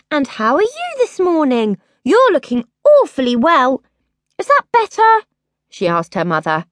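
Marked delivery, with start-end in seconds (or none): 0.1-5.2 overdone politeness. A narrator's reading. Princess greeting the druid with fake sweetness. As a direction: lay it on thick, sarcastically.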